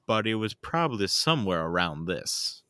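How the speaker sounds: Southern accent, sliding down in pitch at the ends. Shown as a demonstration. Voice actor recalling a Brave Star audition, in a Southern accent. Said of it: "can you do a Southern accent".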